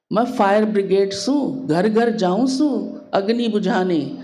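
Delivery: proud Haryanvi village boast; lightly imitating a proud Haryanvi mother; rough rustic Haryanvi accent. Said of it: "उसकी तरह तो पूरा नहीं बोल सकती हूँ हरियाणवी भाषा".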